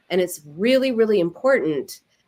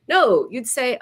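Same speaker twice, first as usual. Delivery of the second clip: ordinary talk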